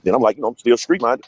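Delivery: ordinary talk, nothing marked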